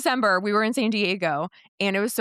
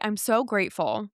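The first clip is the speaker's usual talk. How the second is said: ordinary talk, nothing marked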